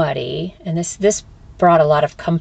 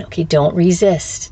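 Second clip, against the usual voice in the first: usual speaking voice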